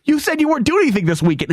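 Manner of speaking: high-pitched imitated hurt protest; mock hurt. Barber learning of the hidden wedding, mock hurt. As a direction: act out a wounded barber. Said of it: "he looked legitimately hurt".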